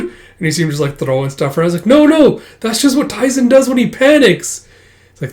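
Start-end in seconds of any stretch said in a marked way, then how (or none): 1.9-4.7 high, loud interjection, acted out; alarmed protest. Explaining Taizen's panic as things fly, alarmed protest. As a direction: jump in to explain at a high pitch. Hurried defence during quarrel.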